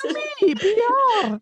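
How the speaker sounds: very high, cartoony game-hero voice; hammed-up high impression; Mario. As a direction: announce yourself like a high-voiced video game plumber. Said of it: "You sound like Mario now".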